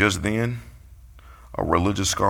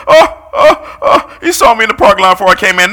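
much higher, loud, squealing alarm; flustered church lady; 12 semitones higher, 12 dB louder than usual. Retold or imitated. Preacher imitates a churchgoer who thinks she was spotted, high and loud. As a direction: panic like you've been caught. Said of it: "she's like".

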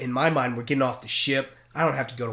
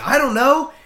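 much higher, louder panicky denial; nervous denial; 13 semitones higher, 11 dB louder than usual. Retold or imitated. Cadet dodging the captain's question, much higher and louder. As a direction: squeak it out, caught.

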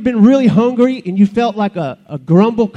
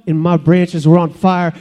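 normal talking voice

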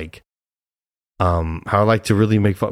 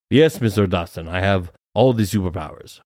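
ordinary delivery

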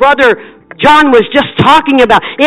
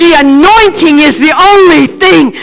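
higher, louder, driving preacher's cadence; full-force preaching; 6 semitones higher, 5 dB louder than usual. Real, not acted. Evangelist urges the congregation toward more anointing, fervent and loud. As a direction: build the congregation up with fervor.

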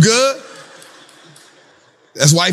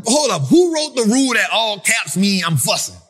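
normal talking voice

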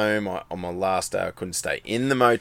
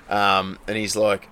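normal talking voice

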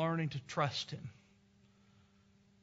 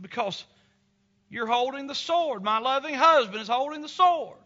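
loud, high, glowing certainty; trusting wife; 9 semitones higher, 8 dB louder than usual. Retold or imitated. Wife answering her husband, high and loud with trust.

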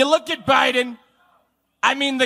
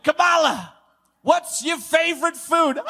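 mock interviewer; 6 semitones higher than usual. Acted question to the vice president.

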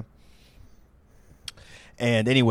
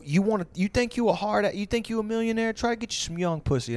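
much higher, swaggering mock gangster; wigger voice; 10 semitones higher than usual. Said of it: "I'm doing the voice".

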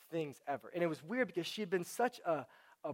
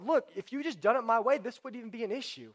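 raised, louder exasperated rebuke; mock scolding; 7 semitones higher, 7 dB louder than usual. God to someone who did it their own way, mock scolding. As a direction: act out an I-told-you-so, higher and louder.

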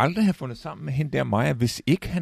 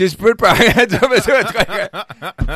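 laughing; 9 semitones higher, 10 dB louder than usual. Real, not acted. Laughing while retelling spittoon scene.

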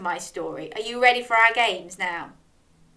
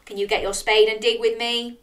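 ordinary delivery, nothing marked

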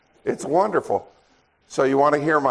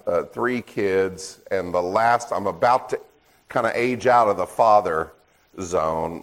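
ordinary talk, nothing marked

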